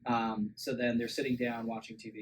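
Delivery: ordinary talk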